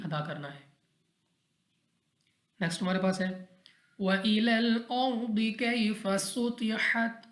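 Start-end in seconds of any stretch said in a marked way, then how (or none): 4.0-7.2 slow, drawn-out, higher melodic recitation; Quran recitation. Acted. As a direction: chant each verse slowly with long held vowels. Recites Quran verses slowly.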